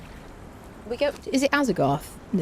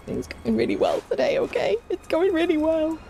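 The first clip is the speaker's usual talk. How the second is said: high-pitched, squeaky denial; mock-cheerful insistence; 11 semitones higher than usual. Player insists to viewers it's going well, mock-cheerful insistence. Insisting all is fine after mishaps.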